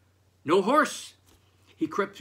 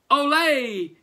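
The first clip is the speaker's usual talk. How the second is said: high, loud flamenco olé, much higher; joyful shout; 11 semitones higher, 11 dB louder than usual. Acted. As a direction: shout olé as he bursts in dancing. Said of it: "he shouted".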